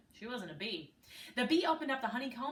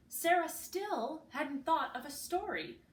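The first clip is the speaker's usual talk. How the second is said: ordinary delivery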